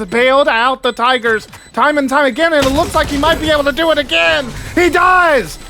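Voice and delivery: excited shout. Real, not acted. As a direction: erupt as the hero play lands. Hero play saves the round.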